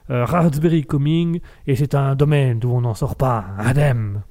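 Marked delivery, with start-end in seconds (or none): 1.7-4.2 declaimed alexandrin. Pretend. Host declaiming a pompous verse to listeners, mock solemn. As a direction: recite it like a line of classical poetry. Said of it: "Un petit alexandrin au passage".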